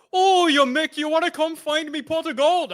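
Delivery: high Irish leprechaun voice; squeaky mock Irish accent. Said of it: "someone dressed as a leprechaun came out with a pot of gold and was like".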